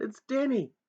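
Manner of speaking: high loud calls; shouting. Acted. Boy shouting for his father in the wood, loud and high. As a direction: shout desperately into the night. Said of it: "I shouted".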